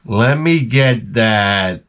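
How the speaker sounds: very slow, dragged-out order; deliberately slow. Shown as a demonstration. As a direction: drag every word out to annoy him. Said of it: "this is where I slow it all down".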